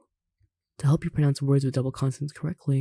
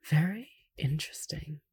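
soft whisper with no voice; whispered aside; 5 dB quieter than usual. Real, not acted. Reader comments aside on the lesson, whispering.

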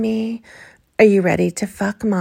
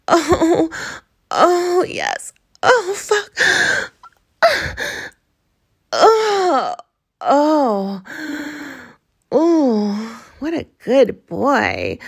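climax moans; 4 semitones higher, much slower than usual. Acted. Climax of roleplay, far timbre.

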